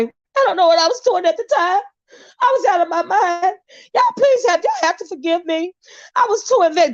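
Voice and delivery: high, crying fake sob through the words; mock tearful apology. A mock-sobbing [name] begging the viewers to forgive her. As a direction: fake the tears to win the audience back. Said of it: "she could put some fake tears on".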